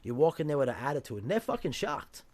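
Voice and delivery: ordinary talk, nothing marked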